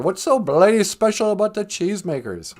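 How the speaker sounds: high-pitched mock outrage; indignant film heckler. Crowd member reacting to a misheard sermon, mock indignant. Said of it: "And they go".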